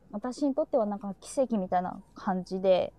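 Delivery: usual speaking voice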